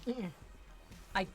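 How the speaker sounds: ordinary talk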